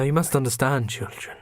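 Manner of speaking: usual speaking voice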